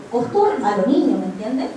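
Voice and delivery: ordinary delivery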